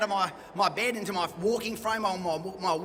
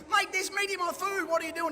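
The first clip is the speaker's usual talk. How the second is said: higher-pitched indignant challenge; retold indignant confrontation; 9 semitones higher than usual. Vegetarian patient confronting the head chef, higher indignant challenge. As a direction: demand answers from the chef.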